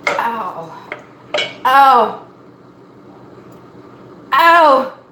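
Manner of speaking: yelping as the onion stings the eyes; real ouch. Real, not acted. Stung by onion fumes in the kitchen, yelping ow. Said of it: "really stinging my eyeballs".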